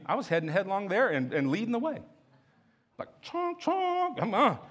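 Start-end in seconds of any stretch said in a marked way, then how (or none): 3.2-4.2 high squeaky chonk sounds; playful high-pitched chonk chonk; mock stomping noise. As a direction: make a silly marching noise. Mimicking heading headlong.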